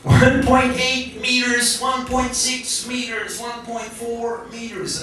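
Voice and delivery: high, slow American car voice; imitation of an American parking-sensor voice; American accent. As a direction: count it out like an American dashboard computer, drawn out and high. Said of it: "in American accent".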